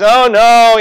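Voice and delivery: high, loud mock "oh no" of alarm; mock dismay. The stricken court crying out in mock alarm. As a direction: play the court's panic, hammed up. Pharaoh's court struck with disease.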